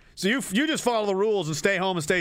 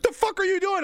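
mock shocked yell; 12 semitones higher than usual. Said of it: "everyone's like".

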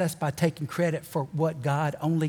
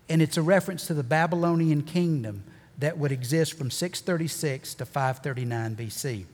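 normal talking voice

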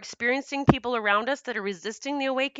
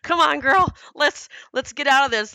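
higher, louder rallying call to herself; self pep talk; 5 semitones higher, 6 dB louder than usual. Retold or imitated. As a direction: cheer yourself out of a slump. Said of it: "I was like".

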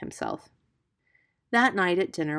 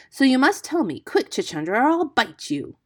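louder, pressing demand; impatient threat; 7 semitones higher, 7 dB louder than usual. A narrator's reading. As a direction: force an answer out of them. Mongoose threatens the muskrat.

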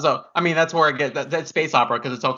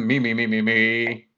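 vocal warm-up. Shown as a demonstration. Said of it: "let me get my dramatic voice on".

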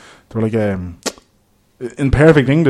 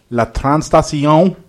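slow, louche mock-French pronunciation; tourist's slow fake French; bad French attempt; 4 semitones higher, 3 dB louder, much slower than usual. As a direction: stretch English into pretend French. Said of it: "Où est".

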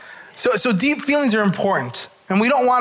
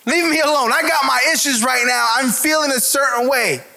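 higher mock toddler protest; imitating frustrated toddler; 5 semitones higher than usual. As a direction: play the toddler refusing, higher. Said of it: "Grace tells him".

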